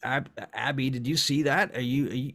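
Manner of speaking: rattled, stumbling questions; panicked questioning. Acted. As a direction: turn to a friend in disbelief. Panicked character turns to friend.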